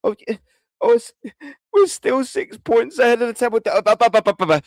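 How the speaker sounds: high, whiny mock voice; mock them in a silly high whine; mocking idiot fans. Mocking naive fans who point at the table, high and whiny. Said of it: "little idiots that'll go".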